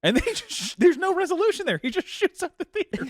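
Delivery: high, laughing squeak of a voice. Real, not acted. Friend describing the theater shootout, high laughing voice. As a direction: squeal it out through giggles. Laughing recap of movie ending.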